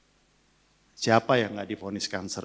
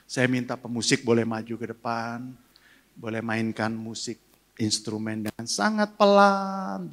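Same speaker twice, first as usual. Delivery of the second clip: worship leading; 3 dB louder than usual. Acted. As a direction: invite the church into prayer, pastor-style. Leads the congregation into prayer. (pendeta memimpin jemaat berdoa, berseru lalu melembut)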